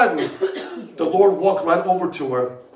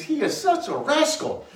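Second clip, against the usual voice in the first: high-pitched quote of her delight; playful high retelling; delighted exclamation; 12 semitones higher than usual. Retold or imitated. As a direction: pitch it way up, tickled. Quotes woman's fond remark on Lord.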